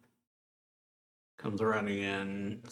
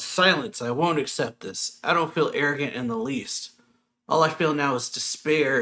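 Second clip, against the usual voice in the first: louder, higher-pitched outburst on the first word; mild retold yell; 4 semitones higher, 6 dB louder than usual. Ruler refusing to accept God's plan, mildly yelling. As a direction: cut them off with a raised, protesting voice. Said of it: "he yells".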